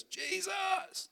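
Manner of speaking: high, far-off cry of a blind beggar; a beggar crying out to Jesus for mercy. Retold or imitated. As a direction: call his name out across a crowd. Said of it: "he cried out to Jesus".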